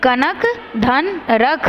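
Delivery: put-on रजत घड़ी पहन spoken slowly for children to repeat; slow model sentence. Shown as a demonstration. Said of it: "मेरे साथ दोहराएंगे". (बच्चों के लिए धीरे-धीरे बोलिए)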